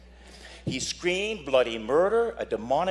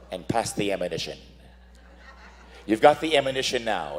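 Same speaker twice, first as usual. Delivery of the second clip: normal talking voice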